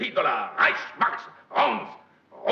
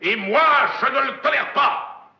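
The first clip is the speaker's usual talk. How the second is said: booming furious tirade, higher and louder; hammy stage actor; 12 semitones higher, 8 dB louder than usual. Acted. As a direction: rage at him like a tyrant. Furious executive's tirade, shouting.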